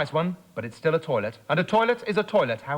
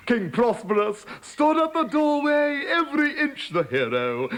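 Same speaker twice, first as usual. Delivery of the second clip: grandiose; 8 semitones higher than usual. A narrator's reading. Heroic king introduced.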